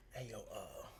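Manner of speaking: hushed whisper to cousin. Retold or imitated. Dion whispering to Jack to take the phone, hushed. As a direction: whisper for help.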